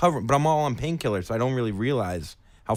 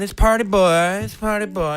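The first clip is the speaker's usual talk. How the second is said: higher, louder hyped yell of the name; party shout; 9 semitones higher, 7 dB louder than usual. Retold or imitated. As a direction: yell it to the whole bar. Shouting his persona at the bar.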